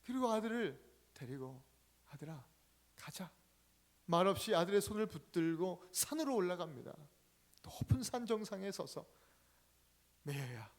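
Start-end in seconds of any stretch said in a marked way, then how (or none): 2.1-3.3 softer, quiet mother's voice; mother quietly telling her son to come; soft motherly call. Acted. Mother gently leads son away. 10.2-10.7 softer, lower mother calling; mother tenderly calling Mayer on the mountain; soft call to son. Acted. Mother softly calls her son.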